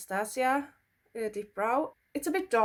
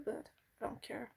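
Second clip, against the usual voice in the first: whisper; 11 dB quieter than usual. Real, not acted. Shrugging off the unknown brushes.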